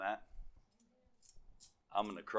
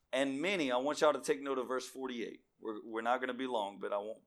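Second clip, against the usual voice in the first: ordinary delivery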